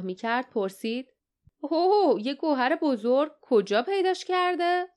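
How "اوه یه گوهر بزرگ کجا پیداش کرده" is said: high, loud opening "oh", then eager questioning; wide-eyed amazed question; acted astonishment. A narrator's reading. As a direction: react amazed, pitch jumping well up. Character reacts to news of gem.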